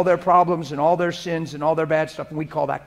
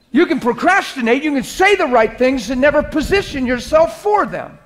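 higher and louder, building to a shout; full-force preaching; 8 semitones higher, 8 dB louder than usual. Acted. Pastor warning the church about a violated conscience, shouting. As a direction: drive it home with fire. Preaching climax, loud and high.

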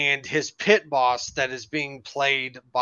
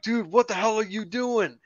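higher, mock-indignant driver; exasperated retold protest; 7 semitones higher than usual. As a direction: demand answers in an acted, higher pitch. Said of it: "And Jan is going like".

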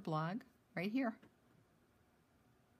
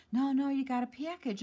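quick, raised-pitch mild mock yell; retold yell; 7 semitones higher, 4 dB louder, faster than usual. Ray yelling news of a package, mild mock yell. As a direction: imitate someone insisting from another room, higher and faster. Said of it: "Ray's yelling to me".

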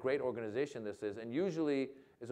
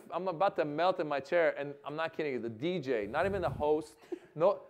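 ordinary talk

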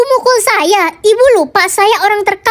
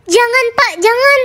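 begging; 5 semitones higher than usual. Acted. Caught girl begs father.

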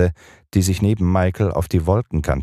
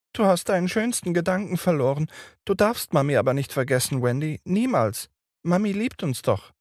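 put-on little boy; 13 semitones higher than usual. A narrator's reading. Little Michael begs his sister, high and tearful. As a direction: plead like a frightened little boy.

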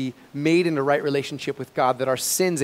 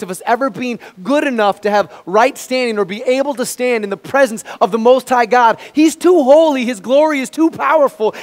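higher and louder triumphant proclamation; preaching at full force; 7 semitones higher, 8 dB louder than usual. Acted. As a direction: shout the good news to the church. Preaching build.

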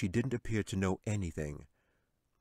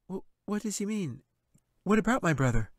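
put-on anxious girl; 12 semitones higher, 5 dB louder than usual. A narrator's reading. Frightened girl asks about brother.

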